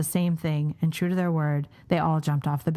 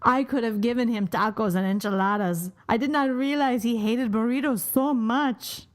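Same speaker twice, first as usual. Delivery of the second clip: lightly put-on Mexican accent, higher at the end; mock Mexican accent; 4 semitones higher than usual. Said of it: "this is the racist part".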